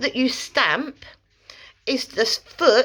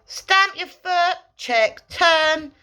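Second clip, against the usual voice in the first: stamp your foot said slowly and higher; deliberate instruction; 8 semitones higher, much slower than usual. Shown as a demonstration. Trainer calling out the steps to a learner. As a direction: spell out each step firmly.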